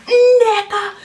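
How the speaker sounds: put-on teacher booming "Nneka" high and loud in a Nigerian accent. Teacher calling the name again in a loud Nigerian accent. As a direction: belt out the name like the stern supply teacher. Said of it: "this man had a very deep accent".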